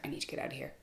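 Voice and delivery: breathless whisper to herself, no voice; panicked whisper. Retold or imitated. Trapped under an angry man, she whispers her panic. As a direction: whisper it like you're trapped and need to flee.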